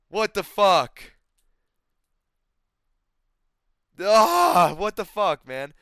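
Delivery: loud, drawn-out what the fuck; real disbelief, letting it burst out; shocked disbelief. Real, not acted. Learning a fighter is his age, loud stunned disbelief.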